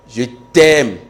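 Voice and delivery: "Je t'aime" with a loud, lengthened "t'aime"; changed tone. Shown as a demonstration. Teacher varies the French phrase, loud changed tone on "t'aime". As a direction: push "t'aime" out loud, falling at the end. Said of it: "lorsque tu changes un peu de ton".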